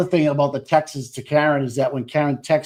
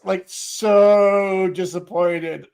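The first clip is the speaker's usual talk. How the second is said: mock disappointed; 3 semitones higher, much slower than usual. Mocking Higgins' disappointment.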